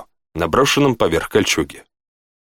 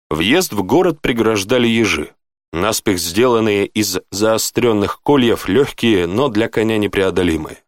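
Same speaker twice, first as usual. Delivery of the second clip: ordinary delivery, nothing marked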